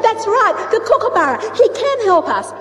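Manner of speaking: high, eager voice of the sky people; sky people spotting kookaburra, high excited voice; excited discovery. Acted. Sky people find the helper.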